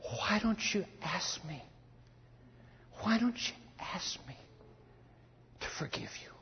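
very soft, near-whispered invitation; soft whisper as God; gentle divine voice. Acted. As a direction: hush right down and offer it tenderly. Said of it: "And that shaft said".

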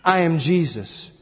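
slow, solemn divine reply; grave voice from heaven; voice of Jesus. Acted. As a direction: answer with measured weight. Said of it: "And the voice responds".

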